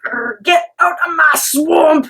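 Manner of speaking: slow, high Scottish roar with rolled R; mock a furious sister's Scottish outburst; exploding Scottish accent. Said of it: "She gets Scottish".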